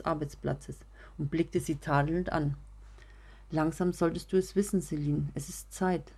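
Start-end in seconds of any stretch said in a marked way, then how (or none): none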